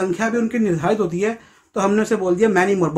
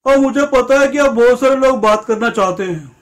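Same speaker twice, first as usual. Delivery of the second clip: higher, louder grandmotherly voice; grandma speaking; 3 semitones higher, 5 dB louder than usual. Retold or imitated. Grandma announcing that many people want to talk, in a granny voice. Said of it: "अब दादी बोली".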